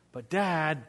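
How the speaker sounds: put-on drawn-out kid's protest; pleading son. Son protesting after dad refuses food, mild pleading. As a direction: lightly imitate a let-down kid. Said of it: "and really frustrated".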